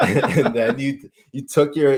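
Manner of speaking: words broken up by real laughter; laughing. Real, not acted. Laughing while recalling the sketch.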